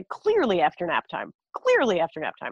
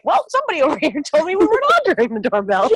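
louder, higher, laughing through the quote; laughing retort; 6 semitones higher, 5 dB louder than usual. Retold or imitated. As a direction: tease him while laughing. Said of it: "I was like".

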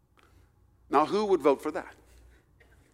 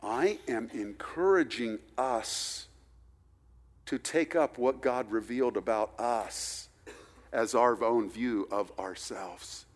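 earnest appeal; 4 semitones lower, 7 dB quieter than usual. Acted.

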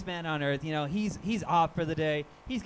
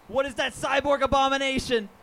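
truck driver's battle cry; 7 semitones higher, 6 dB louder than usual. Retold or imitated. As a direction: bellow it like a crusader charging a machine. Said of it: "and he's just like".

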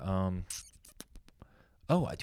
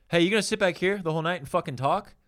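raised, higher-pitched confrontational demand; louder, higher retold challenge; annoyed heckler; 9 semitones higher, 6 dB louder than usual. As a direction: imitate an irritated stranger calling someone out.

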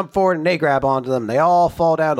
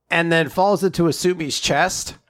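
ordinary talk

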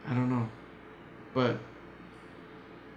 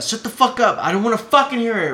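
high, loud enraged yell; retold scream; 9 semitones higher, 7 dB louder than usual. Son pinning his dad to the wall, furious scream. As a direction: shout him down furiously. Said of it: "I told him that".

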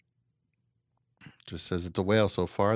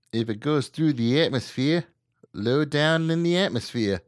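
higher-pitched, hammed-up Australian accent; 6 semitones higher, 3 dB louder than usual. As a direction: read the report as an over-the-top Aussie. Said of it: "doing my best Australian accent".

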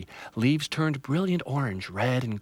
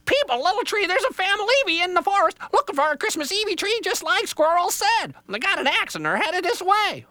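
very high, loud squawky bird; an alarmed jaybird blurting a warning; frantic bird voice; 6 dB louder than usual. Acted. Jaybird warns Little Tree of the axe, high squawk.

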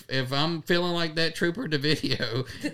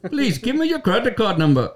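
higher, louder Indian call-centre voice; scam caller accent; 7 semitones higher, 5 dB louder than usual. Retold or imitated. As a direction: play a tech-support scammer.

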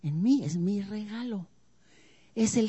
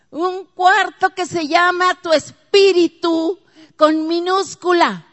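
loud, higher preaching, slowing on each santo; preaching at full force; 7 semitones higher, 12 dB louder than usual. Real, not acted. Preacher rousing the congregation about the holy one, loud and high.

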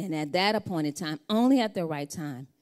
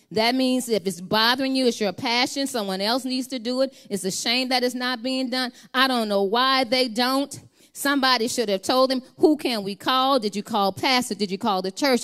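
higher, louder preaching that keeps building; full-force preaching; 7 semitones higher, 9 dB louder than usual. Acted. Preacher urging the congregation not to surrender, preaching hard. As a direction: drive the point home from the pulpit.

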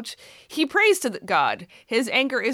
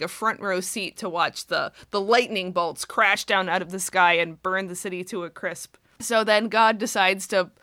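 ordinary talk, nothing marked

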